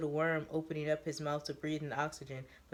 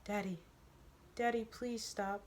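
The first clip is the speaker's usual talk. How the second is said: put-on higher, soft, questioning little girl; little girl's voice; 5 semitones higher, 5 dB quieter than usual. Neo mimics Teo's daughter, soft little girl voice. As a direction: call softly like a small daughter. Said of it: "soft-spoken little girl's voice".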